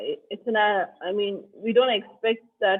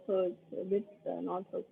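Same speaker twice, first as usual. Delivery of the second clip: barely audible; 12 dB quieter than usual. Real, not acted.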